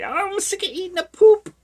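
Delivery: loud falsetto mother shriek; hammed-up shrill outburst; mock furious mother. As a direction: screech it very high, as a fed-up mom. Voicing the fed-up mother charging out.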